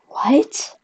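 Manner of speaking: soft, high, rising question; mock baffled little query; retold baffled reaction. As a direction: react in quiet disbelief. Retold baffled reaction after a pause.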